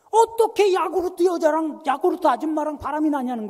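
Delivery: high, loud indignant retelling; hammed-up outraged wail; mock screaming outrage. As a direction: cry out in wounded disbelief, imitating her. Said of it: "악을 쓰면서".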